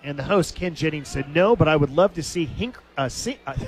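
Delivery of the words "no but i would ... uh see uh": stumbling into helpless laughter; laughing too much to speak. Real, not acted. Announcer retelling a game show host's quip, laughing too much to finish. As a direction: try to quote it but crack up, words breaking off. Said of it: "I'm laughing so much I can't even spit the words out".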